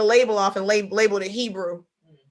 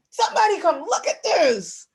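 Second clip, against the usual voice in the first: higher excited call; God calling people over to look, excited and higher; 8 semitones higher than usual. Retold or imitated. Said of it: "He like".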